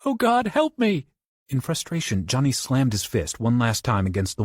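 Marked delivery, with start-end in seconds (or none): 0.0-1.0 much higher, louder, slow terrified cry; terrified plea. Acted. Bobby begs for help in rising water, high terror. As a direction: beg in terror. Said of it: "more terrified than ever".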